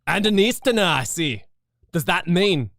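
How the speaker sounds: loud Scottish governor questioning. Acted. Governor sizing up the lawyer, in a loud Scottish accent.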